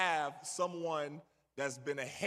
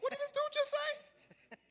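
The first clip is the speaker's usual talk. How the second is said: squeaky high disbelief, about to lose it; voice going high. Retold or imitated. Rookie reacting to the coach, voice cracking high. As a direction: shoot way up high in shock. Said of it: "when your voice gets high".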